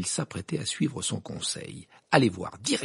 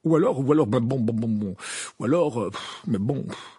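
stammering, puffing, repeating himself; embarrassed Fudge; 2 semitones higher, 5 dB louder than usual. A narrator's reading. Fudge at a loss before Dumbledore, embarrassed. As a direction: fumble for words, flustered. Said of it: "d'un ton embarrassé".

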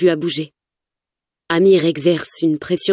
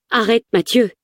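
mild yell; 5 semitones higher than usual. A narrator's reading. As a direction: call out to stop him, pitch lifted. (cri aigu modéré)

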